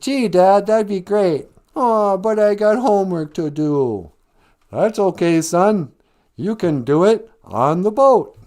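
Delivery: mock a too-perfect TV family; sitcom family impersonation. Bender mocking the nerd's family, hammed-up sweet. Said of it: "my impersonation".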